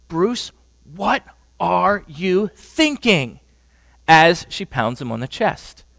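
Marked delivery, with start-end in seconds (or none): 0.1-3.4 slow, drawn-out questioning of her husband; pleading wife. Retold or imitated. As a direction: demand an answer, slowly. Wife confronts husband with fists.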